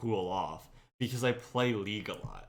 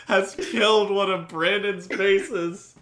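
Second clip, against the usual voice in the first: laughing while talking; 9 semitones higher, 9 dB louder, slower than usual. Real, not acted. Laughing through the punchline.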